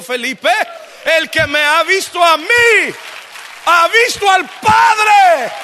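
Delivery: building to a high, slow shout; fiery, drawn-out pulpit proclamation; full-force preaching. Real, not acted. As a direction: thunder the scripture out, stretching the words.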